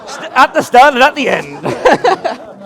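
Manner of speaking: high, slow, laughing call. Real, not acted. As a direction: laugh it out to the room. Laughing, correcting the standing crowd.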